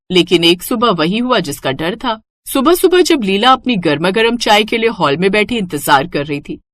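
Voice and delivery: ordinary talk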